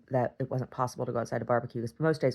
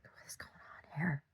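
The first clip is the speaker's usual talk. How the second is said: whispered, puzzled question; an unvoiced whisper of confusion; hushed whisper; 13 dB quieter than usual. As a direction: breathe it out like a baffled onlooker. People reacting to her in the gallery.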